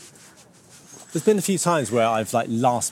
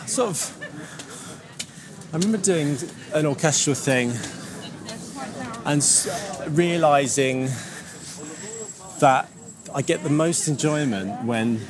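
out of breath from climbing the steps; talking while still catching your breath; 4 semitones higher than usual. Real, not acted. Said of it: "I'm out of breath again".

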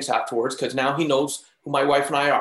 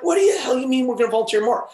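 high, indignant disbelief; retold incredulous protest; 7 semitones higher than usual. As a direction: replay a baffled, outraged comeback. Acting out disbelief at wife's plan.

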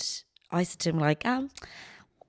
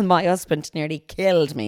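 usual speaking voice

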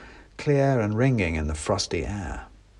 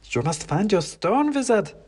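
clacking wooden soldier; 10 semitones higher than usual. Acted. Klaus warns the narrator not to eat, clacking. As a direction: warn in a stiff wooden clack. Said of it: "said Klaus".

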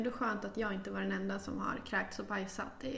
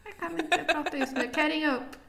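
higher, louder put-on polite phone voice; flustered phone voice; 6 semitones higher, 4 dB louder than usual. To the reporter on the phone, a flustered higher voice. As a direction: caught off guard, asking to call back. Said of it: "sa jag".

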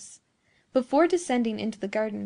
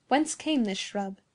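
ordinary delivery

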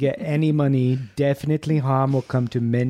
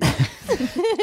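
high villain laugh; 13 semitones higher than usual. Acted.

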